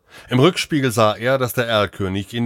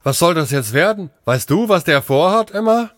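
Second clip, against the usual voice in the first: high, louder startled questioning; baffled, high-pitched outburst; agitated bewilderment; 9 semitones higher, 5 dB louder than usual. Acted. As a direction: ask your companion in rising alarm. Alarmed doctor questions Emma.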